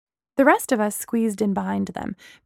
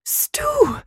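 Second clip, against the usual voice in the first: much higher, breathless joyful cry. Acted. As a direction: call his name in delighted shock. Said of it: "Gemma cried breathlessly".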